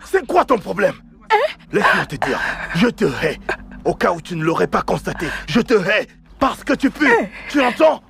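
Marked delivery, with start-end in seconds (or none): 0.1-0.9 higher, louder, fed-up yelling; angry shout. Acted. Son yelling at the girlfriend at his door, furious. 5.5-8.0 higher, cutting insults; angry shout. Acted. Son insulting the girlfriend he wants gone, angry.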